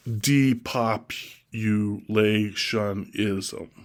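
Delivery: each syllable stretched out slowly. Shown as a demonstration. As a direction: spell it out in sound, very slowly. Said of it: "Six syllables".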